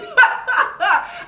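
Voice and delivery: high real laughter; real laugh at her own correction. Real, not acted. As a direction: crack up.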